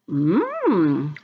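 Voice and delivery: pleased mmm while tasting a berry; tasting hum. Real, not acted. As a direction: hum as the raspberry hits your tongue. Tasting a raspberry on camera.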